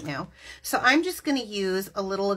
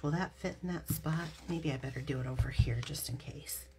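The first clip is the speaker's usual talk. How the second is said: quick whisper to herself; 3 semitones lower, 10 dB quieter, faster than usual. Real, not acted. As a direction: mutter it under your breath. Muttering to herself while stamping.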